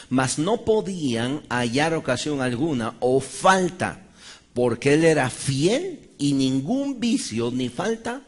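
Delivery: slow, loud, every word pressed; slow, loud preaching emphasis on the verse; emphatic scripture reading. Real, not acted. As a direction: drive each word of the verse home slowly. Preacher reads key scripture.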